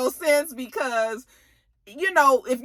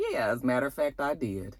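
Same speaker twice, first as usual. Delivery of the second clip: low cold admission; 11 semitones lower, 8 dB quieter than usual. Retold or imitated. Kane admits it coldly.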